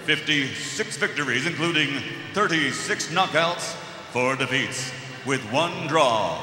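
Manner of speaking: fast stats then booming name; lucha libre announcer. Acted. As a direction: race the numbers, then build to a roar. Fighter introduction.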